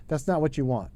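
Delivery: usual speaking voice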